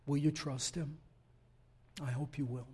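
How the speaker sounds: ordinary talk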